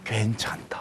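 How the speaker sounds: whispered comfort; soft whisper. Acted. God whispering comfort to sinners, whispered. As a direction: breathe it like a gentle reassurance. God's comfort voiced softly.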